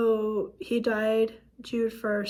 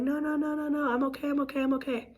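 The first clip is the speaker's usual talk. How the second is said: higher-pitched mock protest, repeated without a break; insistent reassurance; 5 semitones higher, faster than usual. Husband waving away his wife's worry, insistent reassurance. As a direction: imitate someone insisting they're fine.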